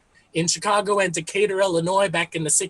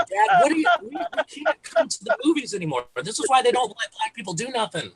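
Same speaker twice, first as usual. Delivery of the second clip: higher-pitched, mildly exasperated son; exasperated scolding; 5 semitones higher than usual. Acted. Son telling off his dad at the movies, exasperated.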